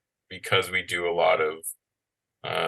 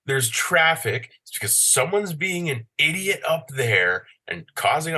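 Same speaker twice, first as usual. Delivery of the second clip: ranting; 9 semitones higher than usual. Retold or imitated. Ranting at traffic.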